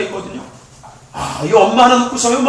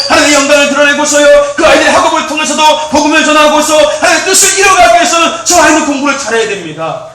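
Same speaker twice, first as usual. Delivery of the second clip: loud, high, driving sermon delivery; full-force preaching; 3 semitones higher, 6 dB louder than usual. Real, not acted. Preacher urging parents on children's success for God, full-force preaching. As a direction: preach in one insistent, raised-voice build.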